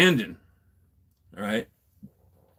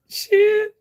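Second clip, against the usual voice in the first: very high, squeaky mimicry; high-pitched imitation; mock exclamation; 4 dB louder than usual. Acted quote of connected pair.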